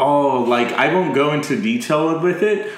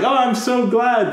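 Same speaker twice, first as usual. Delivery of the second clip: high-pitched imitation of relieved delight; mock gushing delight; 9 semitones higher than usual. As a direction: gush it lightly, pitched well up. Retelling character's delighted reaction.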